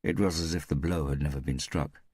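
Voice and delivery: usual speaking voice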